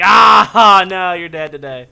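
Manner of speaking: loud laughing yell; mock yell. Other players yelling at the naked guy, loud laughing shout.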